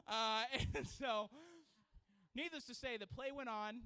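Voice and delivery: normal talking voice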